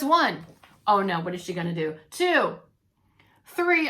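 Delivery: building count. Acted. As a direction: build the suspense to three. Counting before splashing water.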